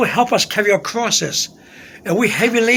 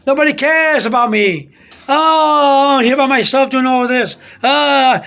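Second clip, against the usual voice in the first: higher, drawn-out lament; raised voice, acting out self-pity; loud self-pitying complaint; 4 semitones higher, 3 dB louder, slower than usual. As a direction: re-enact a loud wail to yourself. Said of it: "I said to myself very loudly".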